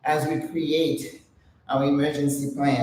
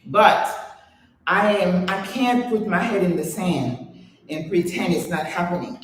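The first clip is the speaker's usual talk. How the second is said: ordinary talk, nothing marked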